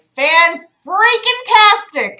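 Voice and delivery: high, slow, drawn-out sarcasm; sarcastic. Real, not acted. Streamer sarcastic to her chat about the setup, drawn-out mock cheer. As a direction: stretch it out dripping with irony.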